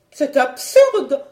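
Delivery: high, loud, drawn-out objection; slow indignant exclamation. Acted. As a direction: dismiss it with indignation. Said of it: "s'indignait Eudoxie".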